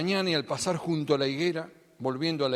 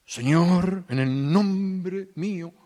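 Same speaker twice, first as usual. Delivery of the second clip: oración imitada; 6 dB louder, much slower than usual. Pretend. Parodia de oración ajena.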